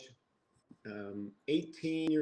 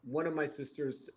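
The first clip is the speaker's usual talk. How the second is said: ordinary talk, nothing marked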